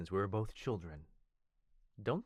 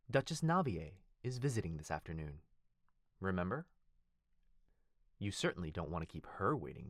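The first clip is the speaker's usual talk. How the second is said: ordinary talk, nothing marked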